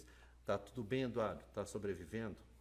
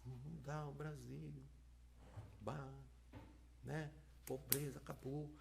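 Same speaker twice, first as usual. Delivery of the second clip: deflated echo; 12 dB quieter than usual. Retold or imitated. Interpreter echoing the politician's speech, soft and deflated. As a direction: mumble the big promises with no energy. Interpreter limply signing the promises.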